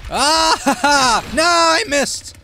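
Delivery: very high, loud cackle into a cry; cackle, then wail it loudly; frantic cackling shout. Real, not acted. Killer swings and misses, frantic high shout.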